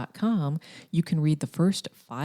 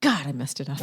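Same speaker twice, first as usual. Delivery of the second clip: ordinary talk, nothing marked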